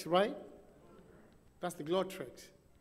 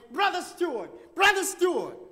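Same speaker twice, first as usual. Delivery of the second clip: high, louder call across a crowd; 7 semitones higher, 5 dB louder than usual. Retold or imitated. Preacher calling the speaker from down the line, loud call. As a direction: call his name to get attention from afar.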